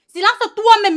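angry retort. Acted. Sister answering her brother's talk of silence, angry retort. Sister throws insult back.

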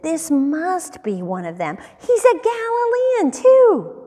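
acted, climbing insistence; insistent accusation. A narrator's reading. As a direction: press the accusation harder as you go. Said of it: "someone else insisted".

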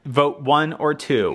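ordinary talk, nothing marked

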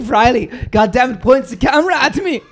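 high, hammed-up German accent; mock German accent. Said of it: "the sickest German accent".

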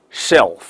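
SHELF with a full dark L before the f; tongue to the upper tooth ridge for the L in SHELF. Shown as a demonstration. Teacher shows learners the second word, clear dark L. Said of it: "L要抵在上齿根部".

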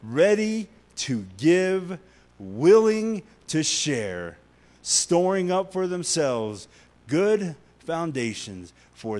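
slow, loud preaching read; preaching scripture. Acted. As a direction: proclaim each phrase slowly and loudly. Reads scripture with force.

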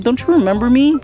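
ordinary delivery

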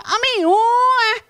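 loud, high garbled wail on "ไม่รู้อะ"; short-uvula speech. Acted. Child with short uvula answers teacher, high slurred "ไม่รู้อะ". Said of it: "พอลิ้นไก่สั้น".

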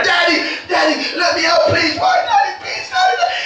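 put-on high, pleading child's cry; crying children. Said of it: "the kids were crying".

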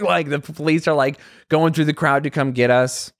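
ordinary talk

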